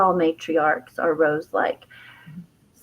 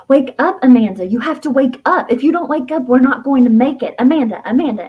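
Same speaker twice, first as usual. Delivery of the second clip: louder, higher, insistent retold urging; urgent pleading; 6 semitones higher, 6 dB louder than usual. Woman shaking her inner child awake, urgent. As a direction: imitate trying to shake someone awake. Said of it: "communicate to her the urgency".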